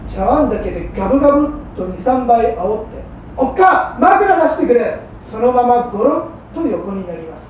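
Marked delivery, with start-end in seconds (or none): 3.4-5.0 calling loud across the room; lazy loud call to the wife; drunken call. Acted. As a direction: holler for the pillow, a bit tipsy.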